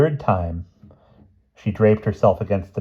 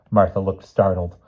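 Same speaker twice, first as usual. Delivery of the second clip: plain reading voice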